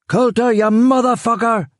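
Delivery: high, shouted name; screamed. A narrator's reading. Killian calling the hiding man, high scream. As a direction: scream the name across the garden. Yelling into garden.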